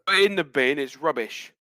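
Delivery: put-on British dismissal; playful mock Cockney; Cockney accent. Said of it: "that was more Cockney than posh".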